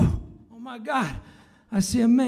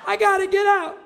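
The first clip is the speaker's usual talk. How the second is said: panicky; 8 semitones higher, 4 dB louder than usual. Acted. Baby panics to escape womb.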